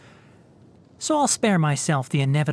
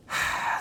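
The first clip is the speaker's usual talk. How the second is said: short, soft sigh; exasperated sigh; 6 dB quieter than usual. Acted. Hearing absurd sleepwalking rumors, an exasperated sigh. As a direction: breathe out, tired of silly rumors.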